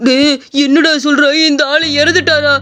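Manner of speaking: horrified realisation; panicked fright. Acted. Shopkeeper hears the old man died a month ago, panicked. Shopkeeper learns he met a ghost.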